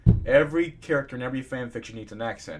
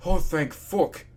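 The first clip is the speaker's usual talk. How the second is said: relieved; 5 semitones higher, 7 dB louder, much slower than usual. Acted. Relief on waking.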